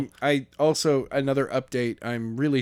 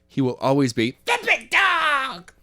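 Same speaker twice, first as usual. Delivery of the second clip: stubborn high insist; 11 semitones higher than usual. Real, not acted. Playful argument, stubborn insistence.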